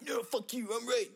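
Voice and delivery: high, sharp, stubborn retort; stubborn defiance. Retold or imitated. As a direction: dig in and refuse. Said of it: "be like".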